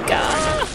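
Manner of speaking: sudden high yelp of fright; startled yelp. Real, not acted. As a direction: yelp in surprise as attacked. Startled by attacking enemy.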